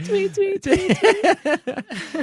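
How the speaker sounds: high chirpy tweeting; parakeet chatter. Acted. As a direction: chirp it like a little bird. As Fetus squeaks in the back.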